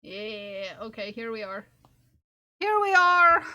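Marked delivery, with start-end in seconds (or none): 2.6-3.4 triumphant. Real, not acted. Triumphant exclamation, much louder.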